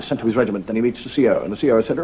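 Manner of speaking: normal talking voice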